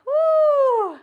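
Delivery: high, loud "woo"; celebratory whoop. Real, not acted. Coach ending the boxing minute, celebratory whoop. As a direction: let out a big high whoop of relief. End of timed boxing challenge.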